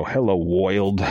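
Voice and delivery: thick New York gangster "Woild"; old New York gangster accent. Shown as a demonstration. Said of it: "when I go".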